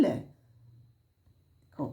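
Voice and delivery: ordinary talk, nothing marked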